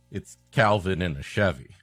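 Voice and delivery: ordinary talk, nothing marked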